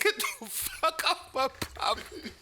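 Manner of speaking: high, slow mock yell; hammed-up angry old homeowner; old man. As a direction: shoo them off the lawn. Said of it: "He said".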